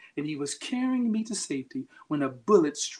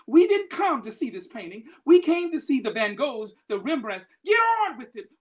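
louder and higher, furious bidder yelling; angry shout; 7 semitones higher, 7 dB louder than usual. Retold or imitated. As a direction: demand it angrily, rising to a yell. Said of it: "Another voice is shouting angrily".

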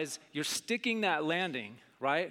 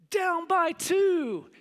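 higher, slow hushed commentator; pretend announcer; 11 semitones higher, 3 dB louder, much slower than usual. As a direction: narrate the final seconds like a broadcaster. Boy imagining game announcer.

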